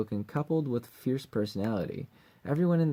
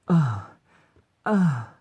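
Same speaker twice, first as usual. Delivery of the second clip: short, raised acted moans; light moan; 4 semitones higher, 7 dB louder than usual. Shown as a demonstration. Voice actor demonstrating line four, light moans. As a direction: give two brief, lighter moans. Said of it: "That's a light one".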